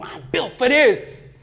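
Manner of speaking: slow, weighted declaration; emphatic resolve. Real, not acted. Preacher declaring his toughness to the crowd, slow and firm. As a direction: lean into each word with conviction.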